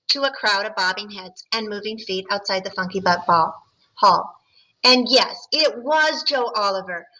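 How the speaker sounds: ordinary talk, nothing marked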